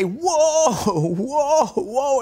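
high, loud desperate calls; frantic rider nearing the cliff edge; panicked whoa. Retold or imitated. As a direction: rein in a runaway horse. Horse racing toward a cliff.

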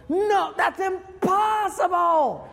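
high, slow shouted no; flat refusal shout. Retold or imitated. Time traveler dismissing a plane of three hundred people, high slow shout. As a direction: throw it back like it's nonsense.

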